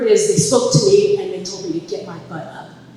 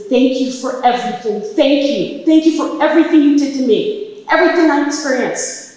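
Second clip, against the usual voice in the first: loud, raised, overwhelmed gratitude; fervent thanks; 5 semitones higher, 8 dB louder than usual. Retold or imitated. On her knees thanking for everything, fervent gratitude. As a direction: pour out thanks at full voice.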